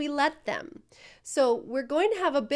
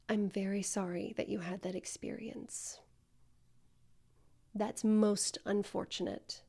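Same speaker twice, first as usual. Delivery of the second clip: calm reassuring reply; 3 semitones lower, 6 dB quieter than usual. Shown as a demonstration. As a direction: soothe the caller, softly and unhurried. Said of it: "take a deep breath before you say anything".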